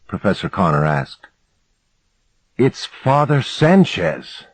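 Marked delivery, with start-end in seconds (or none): 2.6-4.4 drawn-out, higher-pitched excitement; contained excitement. A narrator's reading. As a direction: stretch it out, barely holding excitement in. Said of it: "barely able to contain my excitement".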